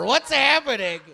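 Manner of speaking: ordinary talk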